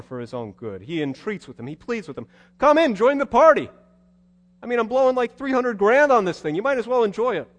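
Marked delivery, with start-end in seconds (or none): 2.6-7.5 high, loud, coaxing invitation; father urging his sulking son to join; eager pleading. Acted. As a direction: talk him into the party.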